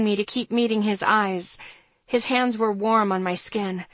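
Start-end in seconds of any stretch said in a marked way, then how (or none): none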